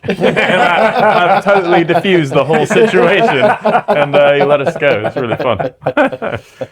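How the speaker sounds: laughing while talking; words bubbling with laughter. Real, not acted. Laughing through the story.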